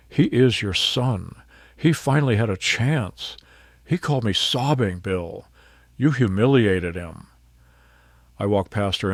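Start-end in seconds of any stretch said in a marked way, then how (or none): 0.1-7.3 loud, shaking, furious wife; screaming with rage. Acted. Wife screams at husband over their son, furious. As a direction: rage at him for humiliating the son. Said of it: "she screamed".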